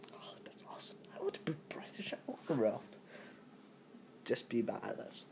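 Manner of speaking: soft excited whisper; a quiet whispered aside. Real, not acted. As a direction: hush it like a secret confession. Whispered aside after the joke.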